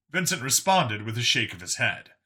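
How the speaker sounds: plain reading voice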